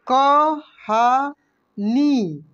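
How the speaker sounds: कहानी drawn out very slowly, syllable by syllable. Shown as a demonstration. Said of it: "इसके बाद देखेंगे यहाँ पे". (कहानी को धीरे धीरे बोलिए)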